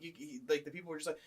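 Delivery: ordinary delivery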